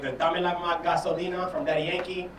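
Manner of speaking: ordinary delivery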